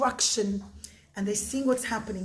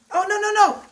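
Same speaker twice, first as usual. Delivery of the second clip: sudden realisation; 8 semitones higher, 7 dB louder, faster than usual. Real, not acted. Storyteller catches her own mistake.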